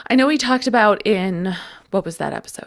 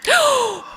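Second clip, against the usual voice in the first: sharp gasp. Real, not acted. Shocked by surprising news.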